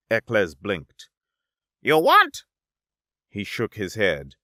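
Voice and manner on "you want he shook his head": high, loud broken-English haggling; pushy merchant creature. Acted. Little merchant twin pushing the alien to buy. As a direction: press the sale in clipped pidgin.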